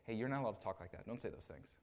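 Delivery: low, hushed talking-to, dropping away; softly voiced private reproof, mimicked; discreet mock rebuke. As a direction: imitate taking someone aside to correct them quietly. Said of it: "takes God incarnate off to the side".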